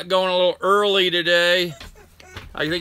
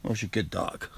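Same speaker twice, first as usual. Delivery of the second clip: much softer and lower, murmured praise; soft praise for the dog; 10 semitones lower, 10 dB quieter than usual. Real, not acted. Streamer quietly praising his dog once she settles. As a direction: hush your voice to praise the dog.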